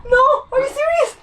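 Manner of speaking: high, frantic crying out; panicked disbelief. Real, not acted. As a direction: plead in horrified panic. Fly in her hair.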